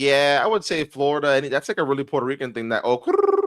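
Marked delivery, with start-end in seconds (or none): 3.0-3.4 "krrr" with a high, long trill; rolled R. Retold or imitated. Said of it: "roll an R".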